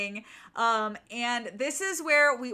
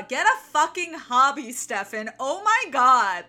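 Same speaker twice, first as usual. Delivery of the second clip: loud, fed-up scolding; exasperated outburst; 8 dB louder than usual. Real, not acted. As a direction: scold a hopeless friend, punching it out loud. Mock scolding advice to a character.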